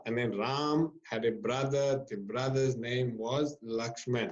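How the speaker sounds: slow, stilted story reading; reading off page. Retold or imitated. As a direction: imitate a novice reading word by word. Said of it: "they read the story of every page".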